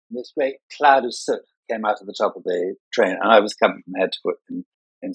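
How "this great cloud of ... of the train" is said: normal talking voice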